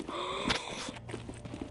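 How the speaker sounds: quick gasp before the shot; sharp inhale. Shown as a demonstration. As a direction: suck in a breath to hold it. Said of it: "they hold their breath".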